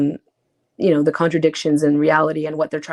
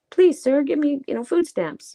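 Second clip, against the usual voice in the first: high, begging little voice; mock pleading; 9 semitones higher than usual. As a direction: beg like an orphan asking for more. Mock plea to the government.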